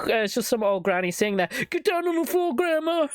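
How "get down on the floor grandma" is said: much higher mock shout of an agent; hammed-up agent yelling at grandma; raiding agent. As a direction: bark orders like a cartoon cop. Mock FBI raid on granny.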